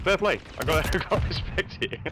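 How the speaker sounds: high-pitched, amused, breaking into laughter; laughing. Real, not acted. Co-caster has to respect a sliding kill, laughing. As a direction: laugh through grudging respect.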